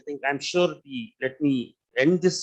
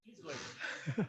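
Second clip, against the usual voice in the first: off mic, distant and faint; mic handed over; 18 dB quieter, slower than usual. Real, not acted. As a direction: far from the microphone. Speaker still away from mic.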